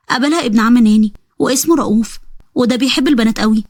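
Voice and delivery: ordinary talk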